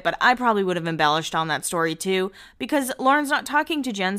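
ordinary talk, nothing marked

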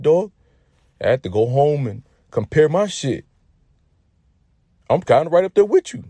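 loud, rising mock earnestness; hyped confession. Friend confessing to his teammate about the shower, hyped mock earnestness. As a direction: confess it high and loud, climbing as it goes.

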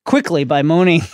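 usual speaking voice

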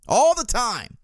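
ALL pitched high, falling through "the time"; emphatic repeat. Real, not acted. Sports fan venting about pet peeve, "All" stressed high. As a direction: punch "All" high and let it fall.

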